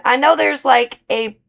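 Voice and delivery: normal talking voice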